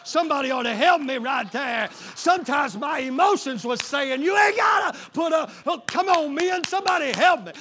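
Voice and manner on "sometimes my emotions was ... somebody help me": loud, high preacher's cry; preaching shout. Acted. Preacher calling on the men, loud and high. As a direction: throw it up to the back row.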